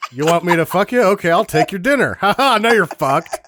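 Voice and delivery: high, loud laughing mock voice; mocking movie characters. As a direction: ham up the characters through laughter. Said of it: "Like these people in this movie do".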